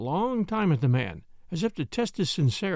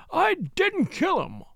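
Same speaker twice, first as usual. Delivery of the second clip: much higher, slow, almost screaming; near scream; 13 semitones higher, slower than usual. A narrator's reading. Butler denying the murder, almost screaming. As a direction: let the voice rise to a scream. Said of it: "His voice rose almost to a scream".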